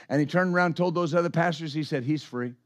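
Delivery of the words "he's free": calm quiet. Retold or imitated. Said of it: "Lawrence was very calm".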